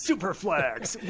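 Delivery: a hint of arena hype, half laughing; monster truck rally announcer. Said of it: "in the voice of a monster truck".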